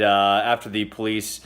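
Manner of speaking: ordinary talk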